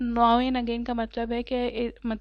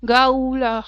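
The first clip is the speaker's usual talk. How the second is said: loud scornful gull cry; mocking gull screech; 9 dB louder, slower than usual. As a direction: screech back at the chick in scorn. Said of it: "she screamed back derisively". (ماں بگلے کی طنزیہ زور دار چیخ)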